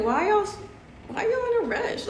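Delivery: very high, incredulous mock question; high-pitched mock disbelief; comic bafflement. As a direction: squeak it out as a playful complaint, close to laughing. Voices her bafflement at rushing crowds.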